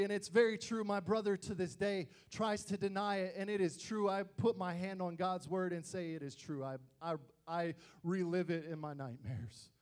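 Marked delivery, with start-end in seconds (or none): none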